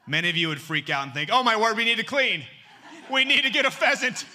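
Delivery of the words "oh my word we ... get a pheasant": high, loud flustered panic; hammed-up hostess scrambling to clean. Churchgoer panicking that the pastor is coming over, loud and high. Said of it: "panic feeling".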